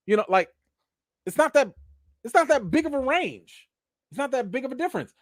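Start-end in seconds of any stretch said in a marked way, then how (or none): 1.3-5.1 pitched way up, insisting; emphatic high. Real, not acted. Arguing to viewers that the range is small, high and emphatic. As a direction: push the point high and hard.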